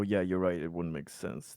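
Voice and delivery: normal talking voice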